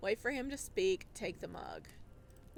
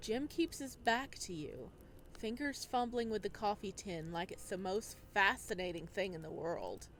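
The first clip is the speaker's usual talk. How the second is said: calm, measured storytelling voice; story narration; 4 semitones lower, 4 dB quieter than usual. A narrator's reading. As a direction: read it like an audiobook narrator.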